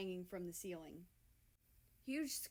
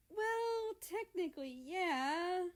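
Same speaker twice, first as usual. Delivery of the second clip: shy; 10 semitones higher, 6 dB louder, slower than usual. Acted. Shy admission of a secret.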